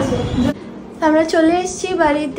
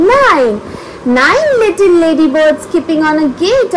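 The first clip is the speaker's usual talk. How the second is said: put-on reading to a toddler; 2 semitones higher than usual. Reads picture book to baby.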